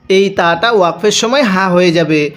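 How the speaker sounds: usual speaking voice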